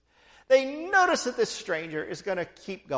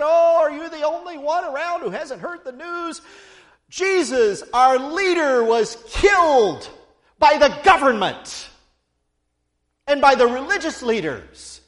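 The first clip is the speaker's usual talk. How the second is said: louder and higher, near a shout on our leader; distressed outburst; 6 semitones higher, 8 dB louder than usual. Acted. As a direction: blurt out the grief, slowing on was killed. Disciples telling stranger Jesus was killed.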